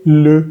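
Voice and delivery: le with rounded "eu" vowel; teacher's careful French "le"; French schwa. Shown as a demonstration. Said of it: "la L con la E se dice".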